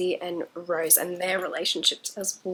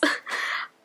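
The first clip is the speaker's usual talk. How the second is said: whispered giggle. Real, not acted. Embarrassed after whispered plea.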